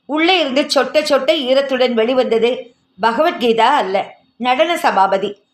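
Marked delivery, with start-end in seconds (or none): none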